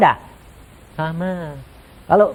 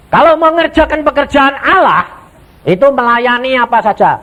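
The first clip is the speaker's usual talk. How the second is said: loud preaching; 10 semitones higher, 6 dB louder than usual. Acted. Preacher hammers the point.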